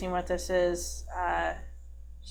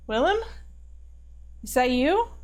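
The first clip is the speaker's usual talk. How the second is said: higher voice, rising into a question; startled hope; 5 semitones higher than usual. Acted. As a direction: call out in disbelief to a lost friend. Character hears lost friend's voice.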